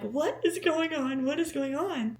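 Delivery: high, drawn-out disbelief; bewildered. Retold or imitated. Woman reliving the moment he points at boats, bewildered. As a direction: repeat it high, overwhelmed and baffled. Acted bewilderment just before the proposal.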